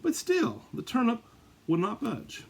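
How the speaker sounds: squeaky high disbelief on but still; dramatic high exclaim. A narrator's reading. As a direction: leap way up in mock astonishment. Suspense beat in read-aloud story.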